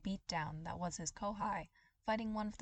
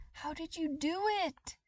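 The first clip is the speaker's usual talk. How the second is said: much higher, louder, desperate plea; villain on his knees, repeating in panic; panicked repeating; 10 semitones higher, 6 dB louder than usual. Acted. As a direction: beg the answer like a broken record. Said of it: "he kept repeating".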